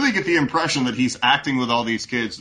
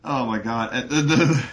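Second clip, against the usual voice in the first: usual speaking voice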